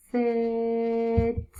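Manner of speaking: "sit" with long hissed s and long vowel; sounded out. Shown as a demonstration. Teacher sounds out a word for new readers, "sit" drawn out. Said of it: "sound it out".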